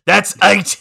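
escalated recited yell. Retold or imitated. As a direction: hammer it home a second time. Repeating it harder.

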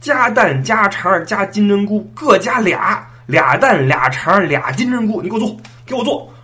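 loud, high, all-out comic outburst; fired-up anger. Acted. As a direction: order furiously, as if going to war. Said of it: "火了！". (火冒三丈地高声加料)

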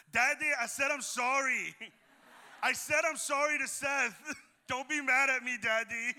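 high, loud mock little-girl wail; loud child's plea. Three-year-old trying to get out of trouble, loud high plea. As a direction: call out to Dad, imitating a pleading child. Girl pleads loudly to angry dad.

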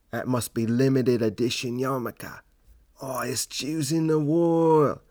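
Australian accent in a hammed-up wildlife documentary voice; Steve Irwin impression. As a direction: gush like a wildlife presenter spotting a rare animal. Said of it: "I talk like Steve Irwin".

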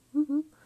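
loud, high laugh; burst of laughter. Real, not acted. Reader laughing at her own outburst: loud high laugh. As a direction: crack up out loud.

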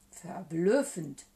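slow, loud monster voice; baffled monster, drawn out; amazed Gruffalo. A narrator's reading. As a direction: stretch the word out in wonder.

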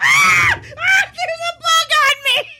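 shrieking in panic at a bug; high panicked shriek; real scream. Real, not acted. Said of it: "I'm glad you're recording".

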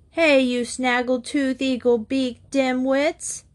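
high, drawn-out taunting call; calling out insults. A narrator's reading. Blake taunts three children from his bedroom window, calling out. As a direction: sing out the jeer to kids down the street.

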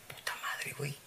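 inner thought whispered; hushed curse to yourself. Retold or imitated.

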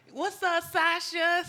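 high, loud hyped-up call; hyped greeting. Acted. Partner greeting Sasha at full volume, hyped. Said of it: "that thing gotta be".

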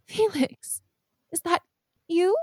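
high, hushed disbelief; barely trusting her eyes; disbelieving. Acted. Mother seeing her long-gone son at the door, disbelieving. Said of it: "her mouth agape, almost as if she doesn't believe it".

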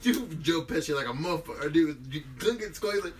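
normal talking voice

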